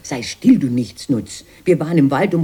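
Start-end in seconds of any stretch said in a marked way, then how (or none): 0.0-0.6 sharp rebuke. Acted. Snapping at brother.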